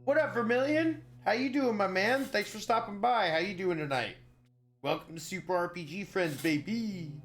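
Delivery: high, loud hype greeting; excited greeting. Real, not acted. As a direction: greet them like a hype host. Greeting a viewer cheerfully.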